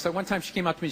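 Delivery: ordinary delivery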